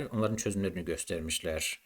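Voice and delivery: ordinary talk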